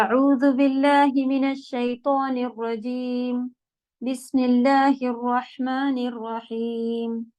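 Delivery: slow, melodic Quranic recitation. Acted. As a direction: recite it slowly, drawing out the vowels.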